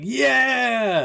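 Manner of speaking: high, bright yell of glee; excited cheer. Retold or imitated. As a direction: cheer it out like a fan. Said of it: "and Wong's like".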